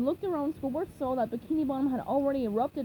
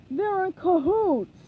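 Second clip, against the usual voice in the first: higher, louder, slow yell; angry shout; 7 semitones higher, 7 dB louder, slower than usual. A narrator's reading. An angry customer shouts at Mr. Krabs, loud slow shout. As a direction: bellow the accusation. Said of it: "shouted an angry customer".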